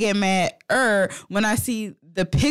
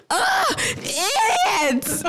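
high, slow, dragged-out squeal; imitating a screaming kid; kid's meme yell; 11 semitones higher, much slower than usual. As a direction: wail it like the viral kid. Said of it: "that video of the kid was like".

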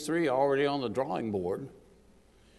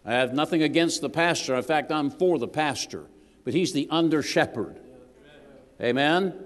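ordinary delivery

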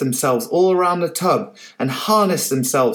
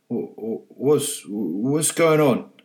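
slow, groggy stammer, just waking; a drowsy old man stirring; slower than usual. Acted. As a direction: mumble it as if half asleep.

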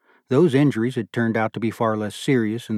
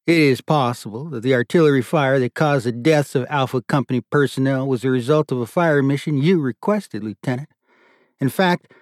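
raised, louder voice of an inquest colonel; stern colonel; 5 semitones higher, 5 dB louder than usual. A narrator's reading. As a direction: question him like a board president. Colonel questions lieutenant at inquest.

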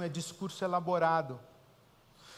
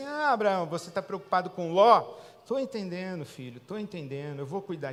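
God speaking kindly but loudly to Abraham; God's voice; 5 dB louder than usual. Retold or imitated. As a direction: boom it out as God reassuring Abraham.

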